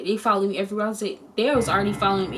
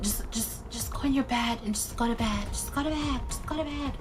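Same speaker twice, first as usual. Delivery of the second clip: much softer, hushing go-to-bed; hushed dismissal; 3 semitones higher, 7 dB quieter than usual. Retold or imitated. Counselor shooing scared camper to bed, soft hush. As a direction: shush the kid off to bed.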